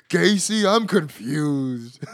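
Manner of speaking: loud, drawn-out confused act; hammed-up bewildered cry, stretching the words; mock confusion. Mocking a confused wrestler's catchphrase.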